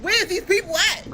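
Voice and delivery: high, insistent interrogation; demanding. Retold or imitated. Girlfriend demanding boyfriend's family, insistent. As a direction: demand answers rapid-fire.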